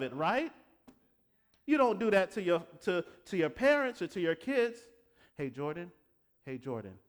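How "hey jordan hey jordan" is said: repetitive filler address. Shown as a demonstration. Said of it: "I don't talk to my daughter like that".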